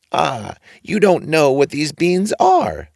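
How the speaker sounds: high, drawn-out agreement; odd old man approving the boy; funny old man, slow. A narrator's reading. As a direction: draw it out knowingly. Old man tempts Jack.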